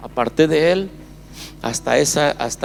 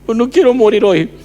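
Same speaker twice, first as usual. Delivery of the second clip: tearful plea; 9 semitones higher, 7 dB louder than usual. Real, not acted. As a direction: voice breaking high and loud with emotion. Reliving desperate plea to God, overcome.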